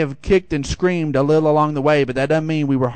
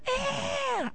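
high held-back shriek in imitation; mock little-girl scream; 8 dB quieter than usual. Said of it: "screaming like a little girl".

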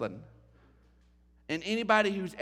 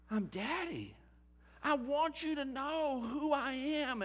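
much softer, choked-up whisper to a newborn; tender, emotional; 4 semitones higher, 8 dB quieter than usual. Real, not acted. Dad softly telling his newborn who he is, emotional. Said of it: "I'm gonna get emotional again".

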